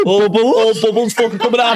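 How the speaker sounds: high-pitched words broken by laughter; can barely get it out for laughing; real laughter, high and slow. Real, not acted. Cracking up recalling the prank.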